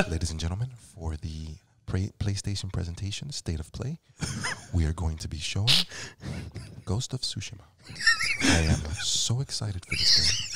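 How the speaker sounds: hushed, halting presenter's delivery; Mark Cerny impression. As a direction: address the audience very softly, with careful pauses. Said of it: "Mark Cerny's voice".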